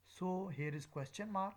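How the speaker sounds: normal talking voice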